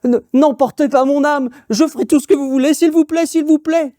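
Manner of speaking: high, urgent begging; pleading. Acted. Kneeling woodcutter begs the witch to spare his soul, high and desperate. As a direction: beg for your soul, voice climbing. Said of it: "il supplie la sorcière".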